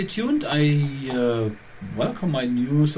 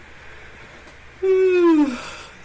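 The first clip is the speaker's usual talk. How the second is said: long high yawn sound; big yawn; 5 dB louder than usual. Real, not acted. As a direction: let a loud real yawn out. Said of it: "I'm yawning again here".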